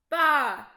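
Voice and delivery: loud bleating baa; sheep baa. A narrator's reading. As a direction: cry out like a sheep. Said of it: "cried Sheep".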